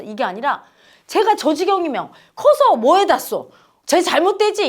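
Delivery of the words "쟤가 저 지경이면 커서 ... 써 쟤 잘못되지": loud, high-pitched anxious fussing; worried fretting. Acted. Mother fretting over how the child turns out, worried. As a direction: fret aloud, pitched up and agitated. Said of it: "이렇게 근심 걱정하는 마음".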